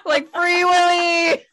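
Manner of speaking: shouting through laughter; laughing shout. Real, not acted. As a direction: cry it out mid-laugh. Laughing outburst.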